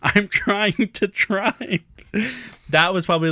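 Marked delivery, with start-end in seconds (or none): 0.0-1.8 high, slow, strained mock wail; imitating laughing-crying mom. Mom at the wheel crying with laughter, mock wail. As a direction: squeeze it out high, as if helpless with laughter. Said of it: "She's, like, crying and her eyes are closed".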